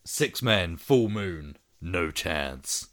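American movie tagline, voice dropping on the last words; American accent. Acted. Film fan reads the tagline to co-host in an American accent. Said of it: "I said that in an American accent".

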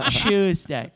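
ordinary talk, nothing marked

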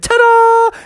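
high, loud flourish; showy ta-da. Acted. Clown strikes a pose after a flip, triumphant. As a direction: announce the trick with a high flourish.

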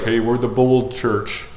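slow, smug mock boast; lightly mock the proud church; mock boastful. Pastor mocking a proud church, smug boast. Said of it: "we get to be like".

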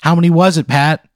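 screaming wife. Acted. Wife turning on the comedian mid-rant, mock screaming. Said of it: "starts, like, screaming at him".

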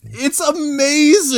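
high, drawn-out exclamation; amazed gushing. Real, not acted. Podcaster gushing over an absurd film scene, amazed. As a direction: stretch it out in high-pitched delight.

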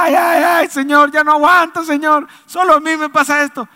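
high, loud mock wailing; hammed-up complaining to heaven; whiny crybaby. Preacher mimicking believers moaning to God in trials, whiny. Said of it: "somos chillones en la prueba".

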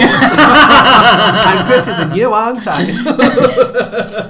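put-on high, loud, slow giggling child; childish voice. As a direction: drag it out like a vain child, laughing. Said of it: "made me think of Stephen Moore".

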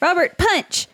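high, urgent call; called-out command. Acted. Player orders Robert to hit the falling rock, calling out.

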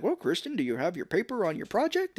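high, prim teacher asking; hammed-up schoolteacher; mock teacher voice. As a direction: put on a prissy teacher. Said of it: "the teacher's all like".

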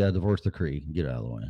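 ordinary talk, nothing marked

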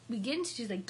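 ordinary delivery

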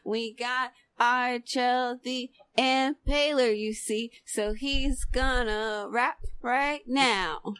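slow, lifted hosting voice; slow emcee patter. Pretend. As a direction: present the next rapper slowly, in rhythm. Emcee introducing the next rapper.

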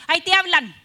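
loud, high punchline shout with a laugh; old woman calling her husband to the door, loud and laughing; old woman yelling. Acted. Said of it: "Corre la viejita y le dice".

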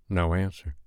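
ordinary delivery, nothing marked

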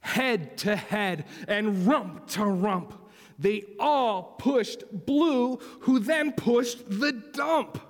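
slow, rising storybook narration; effortful story build-up. A narrator's reading. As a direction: build the big push, word by word. Reads story to children.

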